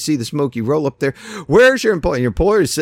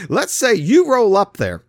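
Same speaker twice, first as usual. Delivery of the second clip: normal talking voice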